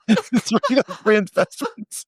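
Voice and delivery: giggling through the description; laughing. Real, not acted. Cracking up at funny image.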